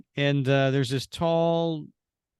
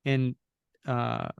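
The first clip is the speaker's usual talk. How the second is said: usual speaking voice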